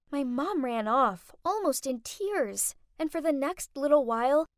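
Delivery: ordinary delivery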